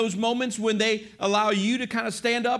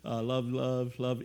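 low, monotone rattled-off endearments; low, soft, automatic repetition; rote, meaningless; 8 semitones lower, 5 dB quieter than usual. Shown as a demonstration. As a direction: toss out love-yous on autopilot.

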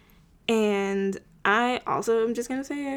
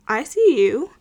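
Okay, Sage, said higher and louder in a put-on teasing tone; teasing Max; 5 semitones higher, 7 dB louder than usual. Max teasing Sage about flirting with Brooklyn, higher and louder. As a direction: tease her like a friend who noticed the flirting. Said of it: "Max is like".

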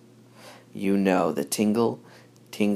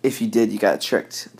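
usual speaking voice

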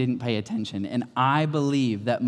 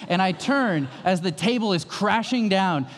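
ordinary talk

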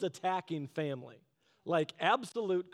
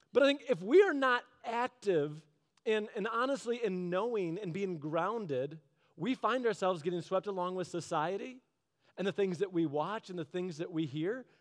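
usual speaking voice

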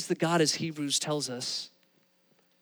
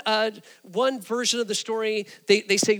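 normal talking voice

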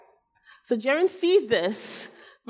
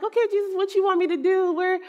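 put-on higher-pitched, scatterbrained kid voice, questions rising; absent-minded son; 7 semitones higher than usual. Mother playfully imitating her forgetful son, absent-minded and high. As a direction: imitate a forgetful boy catching himself mid-thought, pitched up. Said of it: "a little absent-minded. He kinda just lives the world like".

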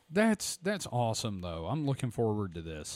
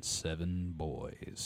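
slow and heavy; 7 semitones lower, 9 dB quieter, much slower than usual. Shown as a demonstration. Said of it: "Let that sink in".

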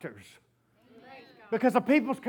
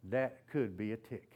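hushed realization; 12 semitones lower, 11 dB quieter than usual. Retold or imitated. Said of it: "I went".

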